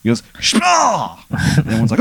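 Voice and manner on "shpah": high, stretched impact sound; mock punch noise. Narrator imitates a punch landing, high drawn-out noise.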